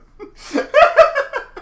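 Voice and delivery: loud high burst of laughter. Real, not acted. Player laughing at a friend's put-on accent, loud high laugh. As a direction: crack up at the accent joke.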